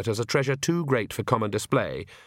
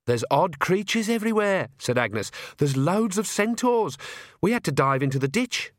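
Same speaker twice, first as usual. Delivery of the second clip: higher-pitched, urgent report; alarmed and put out; Agnes, harried young witch; 7 semitones higher than usual. A narrator's reading. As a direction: tell it as an exasperated young witch.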